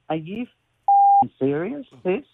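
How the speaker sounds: loud, slow, stunned disbelief; half-crying outburst, each word dragged out in shock. Retold or imitated. Tearful woman shouting at news her parents lost money. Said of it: "And she goes".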